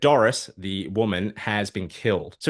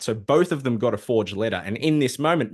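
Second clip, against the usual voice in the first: ordinary delivery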